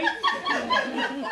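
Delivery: put-on very high, giggly child's laugh; high giggle. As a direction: squeal with a kid's giggle. Boy laughing at waving hand.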